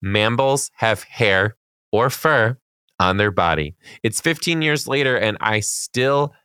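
measured read-aloud with swooping pitch; reading a letter aloud. A narrator's reading. Podcast host reading a teacher's classroom story, measured read-aloud. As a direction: read it out deliberately, slowing on key phrases.

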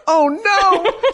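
high, laughing dismay. Retold or imitated. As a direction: giggle it out. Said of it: "I'm like".

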